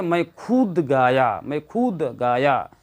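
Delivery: खुद stressed, slow and firm; slow demo stressing खुद; emphatic stress. Shown as a demonstration. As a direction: lean hard on the word खुद. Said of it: "जो अपने आप पर बल दे करके जब बोला जाता है".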